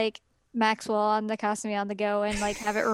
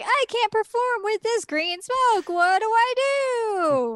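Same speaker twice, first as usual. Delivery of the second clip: whiny panic; 10 semitones higher, 3 dB louder, slower than usual. Acted. Character panicking over smoke.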